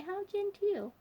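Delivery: high, polite hostess voice; genteel Vanessa. A narrator's reading. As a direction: pitch it high and prim.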